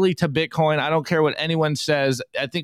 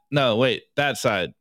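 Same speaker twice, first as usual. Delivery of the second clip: ordinary talk, nothing marked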